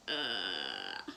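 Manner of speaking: low groan of distaste; disgusted groan. Real, not acted. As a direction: grunt like it tastes bad. Grimacing after green tea sweet.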